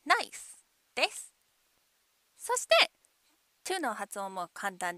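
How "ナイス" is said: "ナイス" as English nice, ending on bare s; no final U. Shown as a demonstration. Teacher modelling "ナイス" for learners, final U dropped. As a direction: say "ナイス" with no vowel after the s. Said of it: "Uを発音しないでくださいね".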